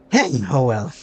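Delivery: ordinary talk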